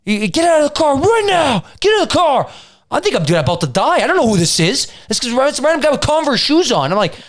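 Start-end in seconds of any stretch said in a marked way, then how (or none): 0.1-2.5 high-pitched mock yell, little extra volume; retold scream. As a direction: imitate a man screaming orders. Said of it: "this guy starts screaming".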